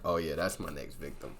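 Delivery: low, soft sinister voice; creepy killer. Retold or imitated. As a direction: murmur like a stalker. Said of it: "the bushes like".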